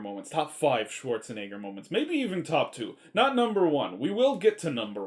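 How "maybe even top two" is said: ordinary delivery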